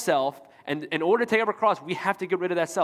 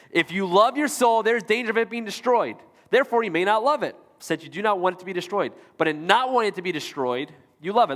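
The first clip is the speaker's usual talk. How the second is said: brisk, emphatic rereading of the quote; quicker reread; 3 semitones higher than usual. Real, not acted. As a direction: read it through quickly, pitched up at the start. Repeats the confusing quote faster.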